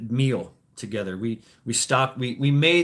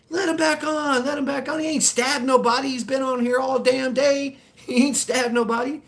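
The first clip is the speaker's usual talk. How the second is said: imitating the crowd in a much higher voice; passengers pleading; 12 semitones higher than usual. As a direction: chant it like the whole bus begging, pitched way up. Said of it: "everybody in the bus was like".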